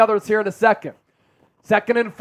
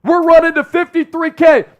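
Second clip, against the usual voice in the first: high, loud rallying cry; hyped shout; 4 semitones higher, 4 dB louder than usual. Real, not acted. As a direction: fire up the crowd. Rallying viewers to sub goal.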